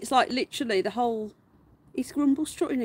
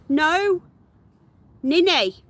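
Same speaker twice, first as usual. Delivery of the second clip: sharp scold; 6 semitones higher, 9 dB louder, much slower than usual. Real, not acted. Scolding pet mid-escape.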